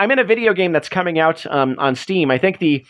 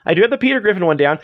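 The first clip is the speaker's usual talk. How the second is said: usual speaking voice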